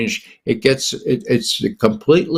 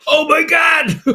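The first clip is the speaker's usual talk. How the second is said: laughing outburst. Real, not acted. Reading Clapper's book in the men's room, bursting out laughing. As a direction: crack up in disbelief.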